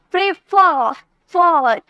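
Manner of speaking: Prefer with फर punched and held, then फॉर repeated alone; stressed second syllable. Shown as a demonstration. Teacher showing students the stress, prefer forceful on its last syllable. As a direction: hit the end of prefer hard, then isolate फॉर. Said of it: "एकदम जोर देकर बोल रहे".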